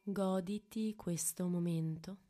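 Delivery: ordinary talk, nothing marked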